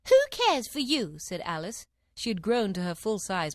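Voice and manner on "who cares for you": high, loud, slow challenge; defiant. A narrator's reading. Alice full size defies the Queen, loud defiance. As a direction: challenge them loudly and scornfully.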